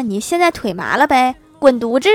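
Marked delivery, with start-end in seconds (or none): 1.6-2.1 louder playful snap; mock-offended wife telling him off; mock angry retort. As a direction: tell him off loudly, half joking.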